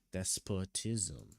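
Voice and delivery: "Despotism" drawn out slowly as DES-puh-tism; practising pronunciation. Shown as a demonstration. Reader practising the new word, slowly sounding out "Despotism". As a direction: sound out "Despotism" slowly and softly with a reduced middle vowel. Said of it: "Is that?".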